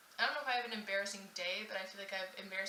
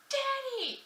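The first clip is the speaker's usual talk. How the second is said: high, loud squeal for dad; excited child's yell; 8 dB louder than usual. Retold or imitated. Six-year-old leaping on a man she thinks is dad, gleeful yell. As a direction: shout it way up high like a little kid. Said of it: "jumped on his back and went".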